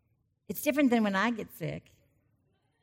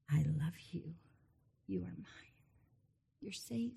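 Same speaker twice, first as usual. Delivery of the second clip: gentle loving voice; 2 semitones lower, 13 dB quieter than usual. Pretend. The still small voice of God.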